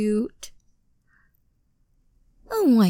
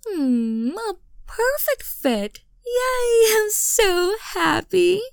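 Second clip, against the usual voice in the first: happy squeal; 8 semitones higher, slower than usual. Acted. Mommy seeing the sweater fit her boy, squealing with happy tears. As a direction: squeal with joy over the gift.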